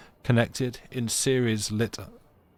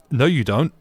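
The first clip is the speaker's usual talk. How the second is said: loud, flat brush-off; a snappy artificer contradicting Paris; curt mock retort; 4 semitones higher, 8 dB louder than usual. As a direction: shut the visitor down. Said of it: "And she's like".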